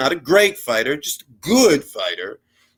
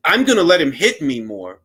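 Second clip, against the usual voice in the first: usual speaking voice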